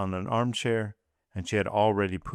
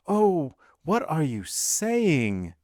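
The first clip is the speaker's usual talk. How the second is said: high, slow cry of dismay; anguished cry; 8 semitones higher, much slower than usual. A narrator's reading. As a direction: cry out, horrified. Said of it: "cried Madame de Villefort".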